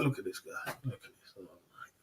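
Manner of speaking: dismissive low murmur; scoffing aside to himself; mock muttered aside. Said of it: "I'm like".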